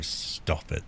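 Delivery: whispered hush; breathy whisper, no voice. Real, not acted. Hushing a pet mid-stream.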